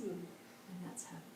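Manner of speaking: very soft, tender near-hush; quiet, kindly lesson; sweetly smiling monk. Acted. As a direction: say it low and gently, smiling. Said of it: "the monk smiles sweetly again".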